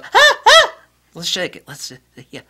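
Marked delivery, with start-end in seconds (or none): 0.0-0.7 very high, loud mock shriek; mock panicked shriek. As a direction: squeal like a frightened viewer. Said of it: "everybody out there go".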